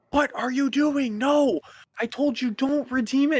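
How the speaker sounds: high, loud retold rage; imitated enraged screaming. Scammer scolds the man redeeming gift cards, imitated high-pitched raging yell. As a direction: mimic a scammer yelling in outrage, pitch way up. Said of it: "screaming in full rage".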